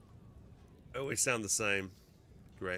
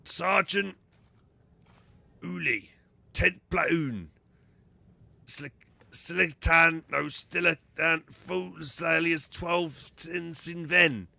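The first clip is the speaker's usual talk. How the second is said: slow, higher, loud Ogryn; 6 semitones higher, 4 dB louder, much slower than usual. Acted. Ogryn struggles to recall his unit, slow Ogryn voice. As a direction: slowly work out each word like a dim trooper. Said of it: "I'm already exhausted from doing the Ogren voice".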